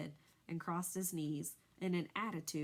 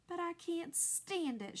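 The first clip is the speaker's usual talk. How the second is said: much higher, slow despairing shout; 11 semitones higher, slower than usual. A narrator's reading. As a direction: cry out against it. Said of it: "shouted Wilbur".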